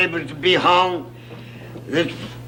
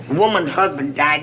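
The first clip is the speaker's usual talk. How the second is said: ordinary delivery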